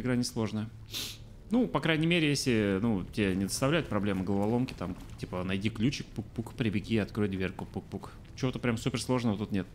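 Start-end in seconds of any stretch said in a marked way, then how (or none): none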